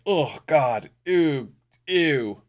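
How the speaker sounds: acted-out cringe; mock disgust. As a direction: recoil with an exaggerated ew. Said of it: "I'm always like".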